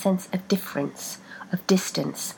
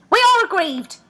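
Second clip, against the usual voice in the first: much higher and louder complaint; two voices grievance; dual aggrieved voice; 13 semitones higher, 9 dB louder than usual. A narrator's reading. Feet complaining to Rebecca, loud dual voice. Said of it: "came a dual answer".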